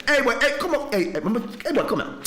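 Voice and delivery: hammed-up customs man ordering him to open the case; bossy customs officer. Acted Jamaican customs officer.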